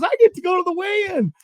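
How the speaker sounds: high-pitched imitation of happy crying; retold tearful joy. Partner gets to go to weigh-in, tearfully overjoyed. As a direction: imitate someone choked up with excitement. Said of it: "he starts crying".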